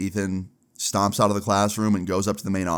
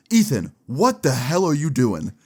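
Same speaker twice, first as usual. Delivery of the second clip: higher mock teacher scolding; teacher's outburst; 5 semitones higher, 3 dB louder than usual. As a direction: imitate a teacher catching a student out. Teacher catches student vaping.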